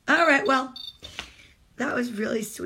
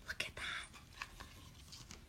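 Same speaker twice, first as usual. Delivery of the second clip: slow breathy whisper; 25 dB quieter, much slower than usual. Real, not acted. Mom marvelling at the moth page, whispering. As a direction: whisper so you don't scare the moth.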